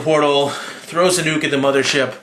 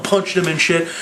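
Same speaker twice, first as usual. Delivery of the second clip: normal talking voice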